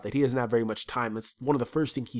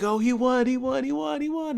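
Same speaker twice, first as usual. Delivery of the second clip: mock crowd cheer; 12 semitones higher than usual. Mimicking townspeople cheering.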